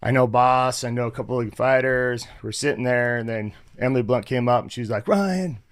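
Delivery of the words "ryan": higher-pitched imitation of a bubbly greeting. Actress greets a newcomer on set, bubbly. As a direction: greet him brightly, as the actress would. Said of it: "She's all bubbly".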